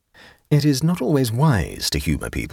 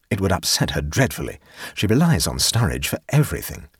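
ordinary delivery, nothing marked

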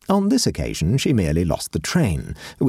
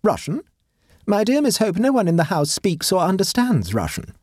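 high, imperious society matron; haughty grand lady; 12 semitones higher than usual. Acted. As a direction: lecture like a pompous hostess. Voices Mrs Cabal.

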